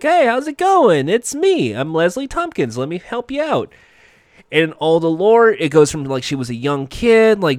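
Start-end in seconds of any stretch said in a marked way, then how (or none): 0.0-3.7 high, perky put-on doctor voice; Leslie Tompkins. Doctor greeting her patient, high put-on voice. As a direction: pitch it up as a helpful greeting. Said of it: "and is like".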